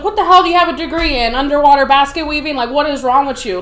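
loud, fast, scornful rant; mocking drill instructor. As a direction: sneer at the recruit's degree. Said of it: "And she's like".

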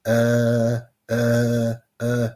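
long, droning uhs, evenly spaced; mocking hesitation fillers. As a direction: imitate a speaker stuck on filler sounds. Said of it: "Stop with the ums, the uhs".

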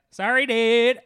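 high, loud witch voice. Acted. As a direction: toss out a loud, high mock apology. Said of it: "then the witch is like".

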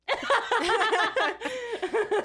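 loud high laugh. Real, not acted. Laughs at sister.

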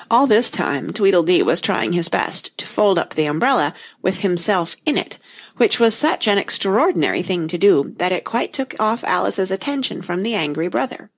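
usual speaking voice

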